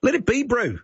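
light Kiwi twang on the bro. Shown as a demonstration. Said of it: "just put the word bro on the end".

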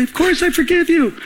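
high-pitched, insistent forgiveness; emphatic reassurance. Acted. Pastor assuring the apologizing caller, emphatic reassurance. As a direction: insist kindly, voice pitched right up.

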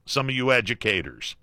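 ordinary talk, nothing marked

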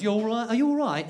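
quick British greeting, words merged; British accent, run together. Acted. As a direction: slur it into one British word. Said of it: "they do it as one word".

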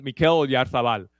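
Oyarzabal said the Spanish way with a lisped z; Spanish pronunciation of the name, like a Spanish announcer; Spanish accent. Real, not acted. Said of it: "I'm saying it with a Spanish accent".

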